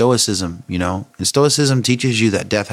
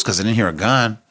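normal talking voice

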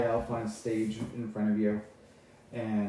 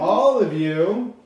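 loud, high, drawn-out proclamation; slow projected speech from a stage; high elf orator; 8 semitones higher, 9 dB louder, much slower than usual. Acted. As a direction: stretch it grandly to the whole hall. Elf's speech to assembly.

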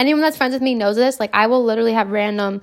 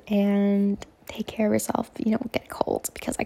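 whispering; 8 dB quieter than usual. Real, not acted. Whispered caring aside.

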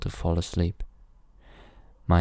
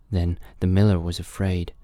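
ordinary delivery

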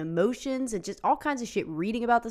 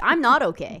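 louder, higher, exasperated outburst; exasperated yell; 4 semitones higher, 8 dB louder than usual. Retold or imitated. Arguing online with a stranger, exasperated louder retort. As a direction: shout it back at him, fed up. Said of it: "And I was like".

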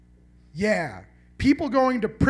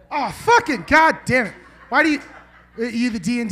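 loud laughing curse, higher pitched; exasperated outburst; 4 semitones higher, 7 dB louder than usual. Real, not acted. Comic to heckling crowd, a loud laughing exasperated curse. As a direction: blurt it out, half laughing at the crowd.

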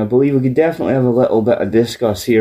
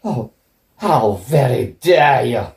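mock offended; slower than usual. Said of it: "was not expecting that reaction".

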